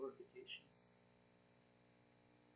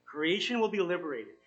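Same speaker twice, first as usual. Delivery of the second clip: ordinary delivery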